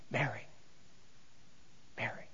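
barely a breath, calling Mary gently; hushed calling of her name. Acted. Pastor voicing Jesus calling Mary, very soft and hushed. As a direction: say her name so softly she can hardly hear it.

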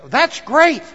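high, loud burst of approval; mock enthusiasm. Preacher voicing people who hear God's Word, enthusiastic. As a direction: exclaim it like an eager churchgoer. Voicing keen listeners who never follow through.

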